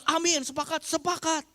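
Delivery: high, eager shouted agreement; hammed-up churchgoer shouting sepakat; mock shouting amen. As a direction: yell amin like an excited congregant. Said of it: "ada yang teriak".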